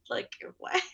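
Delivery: retold amazement, cracking up on the last word; laughing disbelief. Candidate amazed boss liked her resume, laughing disbelief.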